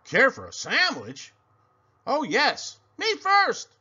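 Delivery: hungry pig can't wait; eager thought. Acted. Pig hears sandwich offer, thinks eagerly. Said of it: "he thought".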